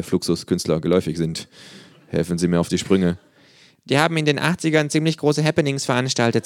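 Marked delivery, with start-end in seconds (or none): 3.8-6.4 high kangaroo voice; knowing kangaroo. A narrator's reading. Kangaroo explaining Flippers to visitor, high knowing voice.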